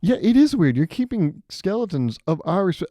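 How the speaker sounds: loud, high-pitched protest; mock indignant. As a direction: protest loudly as if just catching them out. Acted indignant human catching double standard.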